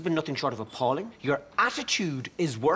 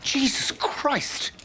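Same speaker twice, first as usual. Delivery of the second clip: shocked gasp; 9 semitones higher, much slower than usual. Acted. Stunned aside.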